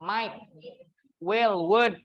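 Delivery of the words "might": "Might" said "mait", with the g and h dropped; "Might" rhyming with "light", no g sound; silent gh. Shown as a demonstration. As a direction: model "Might" as a clean "mait". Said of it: "Kalau might ini G H-nya hilang ya".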